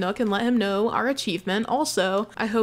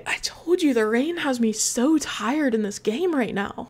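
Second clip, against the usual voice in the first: ordinary talk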